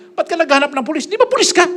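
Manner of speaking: high incredulous teasing; mocking crowd. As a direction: jeer at him in disbelief.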